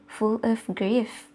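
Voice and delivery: ordinary delivery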